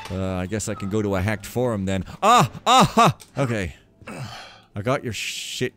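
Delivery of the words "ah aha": loud, high "ah" and "aha"; short high exclamations; sudden cry. Real, not acted. Player reacting mid-game, loud sudden cry.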